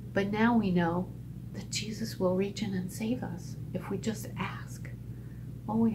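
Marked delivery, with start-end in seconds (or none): 1.5-4.9 whisper. Real, not acted. Tender reassurance to children.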